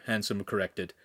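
plain reading voice

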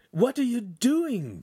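high, loud and slow alarmed cry. A narrator's reading. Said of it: "Pan cried".

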